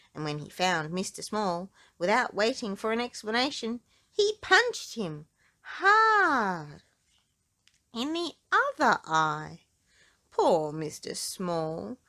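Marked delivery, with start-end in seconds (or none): 4.1-9.6 high, very slow punched words; dramatic emphasis. A narrator's reading. Narrator to children, hammering out the punch. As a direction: land each word like a blow.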